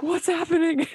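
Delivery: high-pitched playful alarm; mock bewildered panic. Writer baffled by the similarities, high mock panic.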